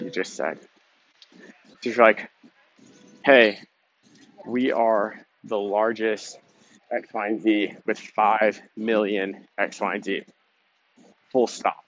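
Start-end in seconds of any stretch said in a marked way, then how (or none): 3.2-11.8 slow, deliberate claim, then a pause before "Full stop"; labeled pause. Shown as a demonstration. Pitching a big claim to colleagues, slow with a labeled pause. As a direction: lay the claim out slowly, let the silence hang, then "Full stop". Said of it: "do you see the difference".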